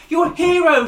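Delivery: high, loud joyful shout; loud cry of you're a hero. A narrator's reading. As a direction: shout it out, thrilled. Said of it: "she shouted".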